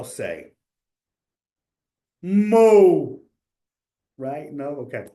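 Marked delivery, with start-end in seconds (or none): 2.2-3.2 loud, high drawn-out moo; mock cow moo. Teacher's cow joke punchline to the class, a loud high moo. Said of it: "You gotta do a little accent".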